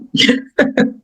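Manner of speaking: high breathy giggle; little laugh. Real, not acted. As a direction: let a small unvoiced laugh slip out. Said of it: "how my laugh comes out".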